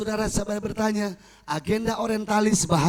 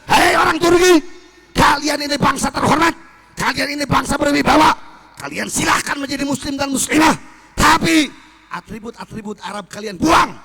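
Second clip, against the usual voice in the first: loud, higher flattering rally cry; shouting again; 5 semitones higher, 7 dB louder than usual. Retold or imitated. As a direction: rouse the nation's pride at full voice. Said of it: "Teriak lagi si Kemal".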